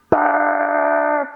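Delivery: playful pretend burp; fake burp. Shown as a demonstration. Teacher pretends to be a full giraffe, playful burp. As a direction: burp it out for fun. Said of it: "Burp with me".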